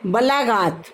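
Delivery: normal talking voice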